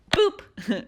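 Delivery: high, bright boop effect; sound effect boop. Real, not acted. Crafter adding a silly sound effect to a snap, high boop. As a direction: pop it out like a cartoon noise. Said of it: "the right sound effect".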